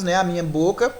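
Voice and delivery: usual speaking voice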